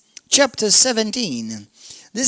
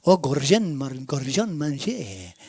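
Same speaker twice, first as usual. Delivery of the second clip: mock French accent, lower and drawn out; 7 semitones lower, 4 dB quieter, slower than usual. Preacher imitating people saying his name in French, French accent. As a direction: say the name like a Frenchman, low and slow. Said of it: "say it in a French accent".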